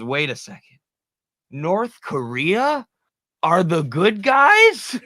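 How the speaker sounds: slow, high, drawn-out mock shock; stunned disbelief. Friend retelling someone's Korean War realization, slow and disbelieving. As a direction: stretch it out like the floor just fell away. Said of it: "He goes".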